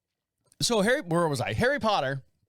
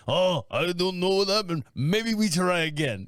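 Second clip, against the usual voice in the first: mock Russian accent. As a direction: play the man in a joking Russian accent. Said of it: "why you went Russian".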